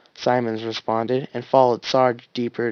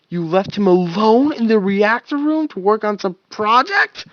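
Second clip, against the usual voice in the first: much higher, louder furious outburst; exploding angrily; 9 semitones higher, 6 dB louder than usual. Acted. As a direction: blow up at them. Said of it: "Wash exploded angrily".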